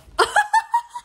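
high, squealing burst of laughter; loud laugh. Real, not acted. Laughing after "Stop.

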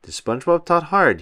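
plain reading voice

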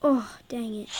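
ordinary delivery

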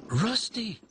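high call to a friend. A narrator's reading. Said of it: "called Skarloey".

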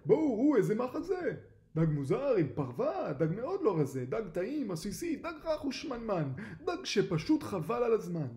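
loud, high excited fishermen; loud, eager hollering; excited calling out. A narrator's reading. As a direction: call your mates over to marvel at the catch. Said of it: "קראו דייגים זה לזה".

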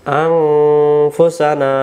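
আনফুসানা very slow, with a nasal ng before f; slow recited Anfusana. Shown as a demonstration. Teacher slowly demonstrating the nasal sound to viewers. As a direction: draw out আনফুসানা syllable by syllable. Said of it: "অনুষ্কারের মতো উচ্চারণ হবে".

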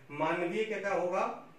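ordinary talk

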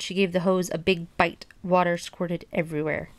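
ordinary delivery, nothing marked

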